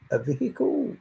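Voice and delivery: playful high echo of vehicle; high-pitched repeat. Shown as a demonstration. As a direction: lift vehicle up high and soft.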